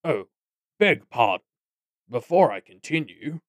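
lightly voiced pompous goblin; mock-polite goblin. A narrator's reading. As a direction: excuse yourself with unhurried mock courtesy. Toadswallow interrupts himself.

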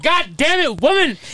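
mock angry husband.

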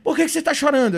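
high, loud retold question; concerned parent. Father asking his son about tears, concerned. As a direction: imitate an alarmed parent on the phone.

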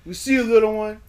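loud, high, hyped-up shout-out. Real, not acted. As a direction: call it out big like a radio host.

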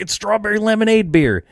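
high-pitched insistence, imitating himself; retold protest. Drinker defending his fruity beer to a scornful buddy, insistent. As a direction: push it up high, selling it.

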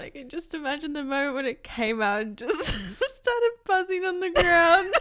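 high, loud, laughter breaking through; picture it and crack up; laughing. Real, not acted. Host imagines the buzzing toy, laughing.

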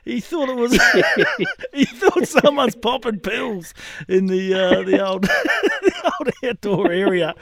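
high, slow words through real laughter; helpless laughter breaking into the sentence; laughing while talking. Real, not acted. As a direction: struggle to finish the story while cracking up. Cracking up at own punchline.

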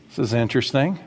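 ordinary delivery

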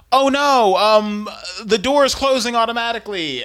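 high, loud, fast mock-innocent voice; mock fake innocent. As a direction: play dumb in a squeaky put-on voice. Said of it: "by being like".

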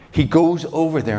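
usual speaking voice

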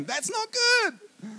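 very high, drawn-out squeal; mock dismay. God imagining a world of all men, high mock dismay. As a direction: squeak it out slowly in comic horror.

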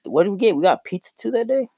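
high, loud incredulous quote; mock disbelief. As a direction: act amazed they bailed. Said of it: "I was like".

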